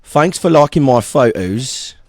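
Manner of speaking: slow British accent demo. Shown as a demonstration. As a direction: try out a casual British accent. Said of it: "the different British, like, ones".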